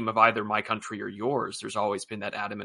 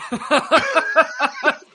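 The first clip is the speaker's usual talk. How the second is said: high burst of laughter; 8 dB louder than usual. Real, not acted. Laughs at host's joke.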